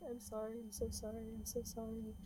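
very soft, quick mumbling; mumbled apology. Acted. Shaking man apologizing over a dying friend, soft mumbling. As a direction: mumble it over and over, barely audible. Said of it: "Like, mumbling that over and over again".

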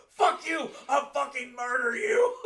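high, very loud mock yelling; hammed-up furious threat. Movie dad threatening his kid, mock furious shout. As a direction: shout it in over-the-top rage, stretching the threat. Acting out film father's threat.

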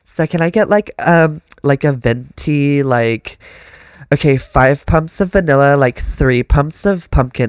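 put-on Valley Girl ordering coffee, slow and drawn; ditzy Valley Girl at the counter. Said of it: "That Valley Girl shit".

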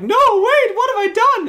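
very high, loud mock shriek of dismay; a shrill damsel's wail, played for laughs; hammed-up panic. Princess dismayed as Tom dives for the ring, shrieking high. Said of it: "she's like".

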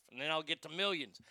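usual speaking voice

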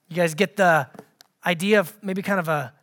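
ordinary talk, nothing marked

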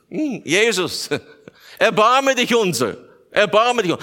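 high-pitched, drawn-out plea to Jesus; pleading blind beggars. Acted. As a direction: act out beggars calling for mercy. Acting the two blind beggars calling.

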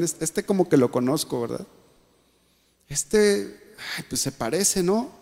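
hushed, unsure musing; inner doubting thoughts. Retold or imitated. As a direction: wonder to yourself under your breath. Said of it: "en su corazón le decían".

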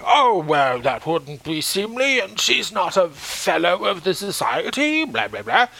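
slow, lightly put-on stuffy gentleman; drawn-out mock of a condescending official; dismissive chairman. Retelling how the chairman brushed Lucy off, slow mock-pompous.

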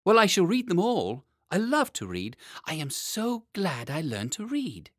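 put-on high, enthusiastic child voice; boy king Lionel, eager. A narrator's reading. Lionel delighted by the library, eager high boy voice. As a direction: gush in a high little-boy voice.

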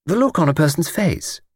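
put-on high, eager elderly lady voice; chatty old lady. Old lady on the train chatting to Luke, high elderly voice. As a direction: play a talkative old lady delighted by a stranger.

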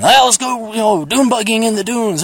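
mock childhood eagerness; imitating excited kid. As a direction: pitch it up like a keen youngster. Acts out excited kids begging to go.

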